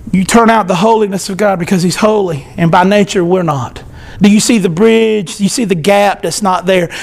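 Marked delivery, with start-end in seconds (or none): none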